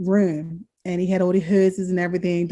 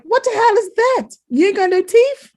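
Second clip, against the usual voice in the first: much higher and louder, stunned; can't believe what she's seeing; shocked disbelief; 13 semitones higher, 6 dB louder than usual. Retold or imitated. Daughter seeing her father's dentures, shocked and loud.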